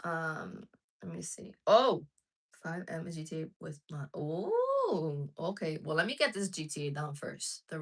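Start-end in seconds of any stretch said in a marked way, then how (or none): none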